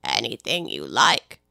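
very low, slow, indifferent drawl; a bored servant, low and slow; slow deep footman. Acted. Footman voice held.

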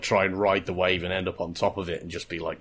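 normal talking voice

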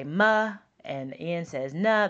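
"ma" and "na" said with an added uh vowel; wrong schwa sounds. Shown as a demonstration. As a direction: sound each letter with an extra vowel. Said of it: "They'll say ... but that's wrong".

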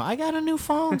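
high-pitched pretend granny voice; put-on grandma. Grandma calling about her new phone, put-on high voice. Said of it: "It's grandma".

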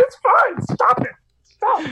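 high squeaky voice, choked up, pleading him to stop; squeaky crying plea. Retold or imitated. To crying roommate, a high squeaky mock-sobbing plea. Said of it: "I was like".

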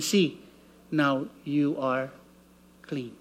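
whisper-soft, let the cleansing land; gentle pronouncement. Retold or imitated. Cherub tells Isaiah his lips are clean, low soft and slow.

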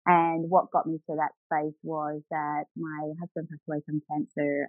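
normal talking voice

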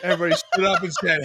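ordinary delivery

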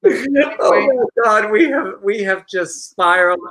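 laughing hard while talking, slow and high; real laughter breaking through the words. Real, not acted. Host cracking up at the winking boomerang joke, laughing.

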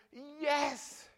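high, loud cry of praise; loud, fervent shouting toward heaven. Shown as a demonstration. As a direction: cry out to God with everything. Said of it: "You can scream".

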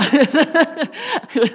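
a high burst of real laughter; laughing at her own joke. Real, not acted. Preacher laughing at her strict curfew, a bright laugh.

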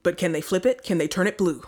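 dramatic breaking-news anchor; news anchor impression. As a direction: tease it like cable news. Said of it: "our impression of all of the news".

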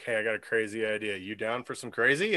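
low, soft, quick pitch of a scheme; Andral. Retold or imitated. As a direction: murmur it like a sly proposition, mock-casual. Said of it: "Andral's like".

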